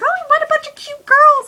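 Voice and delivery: very high, mocking squeal; gushing onlookers. Mimicking everyone at the event, gushing in falsetto.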